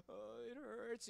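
high, soft, drawn-out whimper; mock crying. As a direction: imitate someone whimpering slowly in pain. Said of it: "she's, like, crying".

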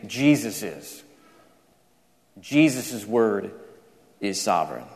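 low, soft, with weighty pauses; hushed conclusion. Acted. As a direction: drop low and quiet to land the point. Preacher lands his point in John's words.

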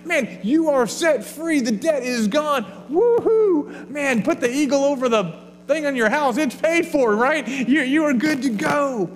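hammed-up joy, woohoo leaps high; playful mock celebration of being set free; elated retelling. Retelling the forgiven servant's joy.

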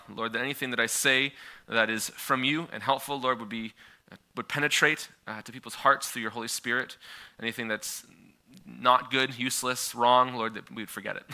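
hushed earnest opening prayer. Real, not acted. Opening prayer before sermon.

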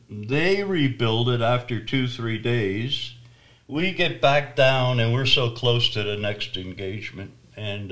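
normal talking voice

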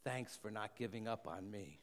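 soft, hushed murmur close to the ear; a soft whispered thank-you in the ear. A narrator's reading. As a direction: breathe it gently like a secret. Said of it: "hear these words whispered in your ear".

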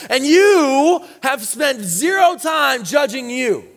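high, driving pulpit preaching; preaching at full force. Acted. Pastor urging the church to judge themselves, preaching at full force. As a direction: preach it hard to the congregation.

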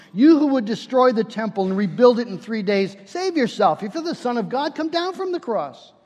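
preacher voicing the mockers; mocking. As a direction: jeer it as a challenge, climbing in pitch. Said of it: "they mock me".

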